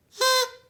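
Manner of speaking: big theatrical gasp, high and loud; loud storyteller gasp; dramatic gasp. Acted. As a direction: gasp in mock shock for the kids.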